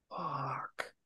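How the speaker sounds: breathless whispered swear under the breath; a sudden dread-filled whisper; whispered curse. Retold or imitated. Realizing a partner broke his arm.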